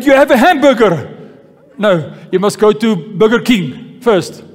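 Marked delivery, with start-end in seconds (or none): none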